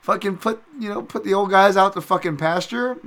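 high, loud indignant question; indignant rant. Acted. Fan accusing UFC of discarding old fighters, indignant. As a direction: keep berating them, pitched high and loud. Still berating UFC over matchmaking.

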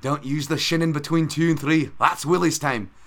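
a bad, belted Scottish janitor brogue; Groundskeeper Willie. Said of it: "these fucking terrible accents and Simpsons quotes".

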